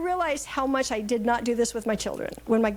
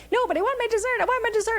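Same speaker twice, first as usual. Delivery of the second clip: put-on high, whining six-year-old wanting her dessert; whiny granddaughter; 11 semitones higher, faster than usual. Grandmother imitating a six-year-old's tantrum, high and whiny. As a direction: whine it like a kid denied a treat. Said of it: "She starts to want to fit".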